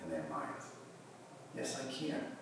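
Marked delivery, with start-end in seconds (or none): 1.5-2.4 small, high, soft inner voice; voice in the mind. Acted. Yoga teacher voices a student's inner answer, softly. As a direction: say it softly and higher, as if from the back of the mind. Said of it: "hearing in the back of your mind".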